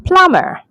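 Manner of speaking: "plumber" as PLUM-er, high then falling; silent B. Shown as a demonstration. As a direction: say "plumber" with no b, high first syllable. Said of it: "this word is pronounced as".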